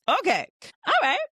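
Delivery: high, loud hyped-up okay; mock excited reaction. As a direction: act hyped up.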